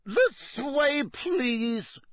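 high, brisk teacher voice; a stern witch herding pupils along; brisk Professor Grubbly-Plank. Acted. Teacher ushering pupils around the paddock, brisk and high.

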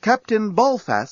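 high, slow, deliberate address; cool voice. A narrator's reading. Nettie addressing the captain as time runs out, cool voice. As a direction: address him with cool control. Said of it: "said Nettie in a cool voice".

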